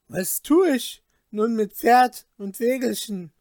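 loud anguished outcry; crying out. Acted. Ruined Peter cries out to the Glasmännlein, loud and desperate. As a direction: cry out in despair, loud. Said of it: "rief er".

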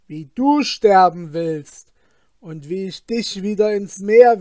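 loud, triumphant threat; triumphant calling. Acted. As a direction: call out in triumph over the trapped genie. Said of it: "Dann rief er".

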